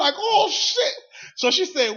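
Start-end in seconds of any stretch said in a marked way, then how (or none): 0.2-0.9 high, loud yelp of dismay; mock alarm. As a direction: cry out in comic panic. Reliving his alarm.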